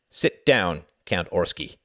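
loud, stressed order to sit; harsh command. A narrator's reading. Jorgen orders the Count to sit, harsh command. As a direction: command him, biting into the syllables. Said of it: "his voice shredding the syllables".

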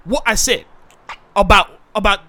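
defensive South London man, pleading his case; put-on South London accent; South London actor character.

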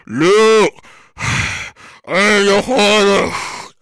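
low, slow breathy Vader voice with heavy mask breaths; slow deep menacing villain with sighing breaths; Darth Vader impression. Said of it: "the Darth Vader effect?".

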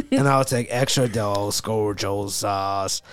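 low, dragged-out singer impression. Friend ordering at Del Taco, still in the slow singer voice. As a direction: stretch the food order out in a low voice. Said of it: "still in the voice".

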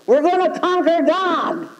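a hint of mock bravado; grandiose ego. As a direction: puff up like a conqueror. Voicing the conquering ego.

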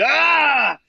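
loud, high "boo"-style shout; imitated scare yell. Drunk fan scaring people in the elevator, mock yell. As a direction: jump out yelling to startle them. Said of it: "pop out from the side and try and scare 'em".

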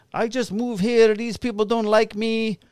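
high, whining mock Polish accent; Polish guy. Handcuffed Polish man pleading with police on porch, mimicked high accent. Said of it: "he's like, he's sitting on the porch".

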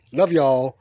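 loud, hearty send-off; called-out farewell. Real, not acted. Host saying goodbye to his listeners, loud call. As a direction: holler it out to the listeners.

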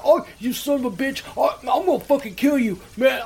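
high-pitched imitated rage; retold mad caller. Prank victim threatening the caller, mock-furious. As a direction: mimic an outraged man making threats. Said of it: "this guy's getting mad".

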